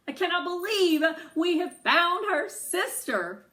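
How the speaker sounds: high, loud gleeful crowing. Acted. Girl crows to Noah about finding the sister. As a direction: crow it out in delight. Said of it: "I crow".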